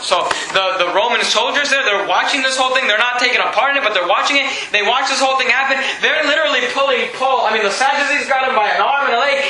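ordinary talk

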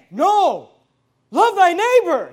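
preacher mocks a shouted love thy neighbor; mock loud preaching. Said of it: "he didn't say".

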